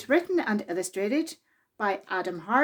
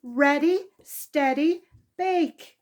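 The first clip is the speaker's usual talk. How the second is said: called out start; 5 semitones higher, 4 dB louder, slower than usual. Acted. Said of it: "called Mister Clever".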